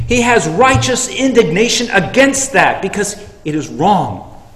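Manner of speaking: loud, high, driving sermon delivery; fiery preaching. Acted. Preacher urging the church to share the gospel, loud and fervent. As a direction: preach it hard to the congregation.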